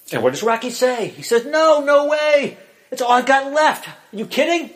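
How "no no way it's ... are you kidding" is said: high-pitched, incredulous retort; indignant refusal. Retold or imitated. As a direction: act out a stubborn, disbelieving refusal. Acting out Rocky's refusal.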